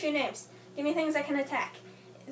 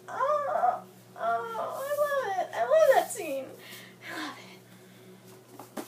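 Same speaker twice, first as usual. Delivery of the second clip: teary gushing; 7 semitones higher than usual. Retold or imitated. Gushing over the kiss scene.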